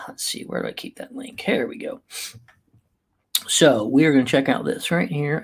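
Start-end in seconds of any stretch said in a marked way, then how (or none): 0.1-2.0 whispered to himself while searching; whispered aside. Real, not acted. Streamer whispering while looking for his email link, hushed. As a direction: mutter it under your breath in a whisper.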